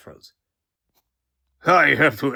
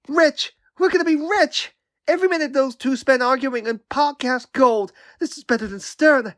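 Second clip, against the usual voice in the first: high-pitched, giddy gloating; gleeful excitement; 11 semitones higher than usual. Acted. Onlooker thrilled by podcast gold from the argument, gleeful excitement. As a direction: crow with delight at a money-making idea.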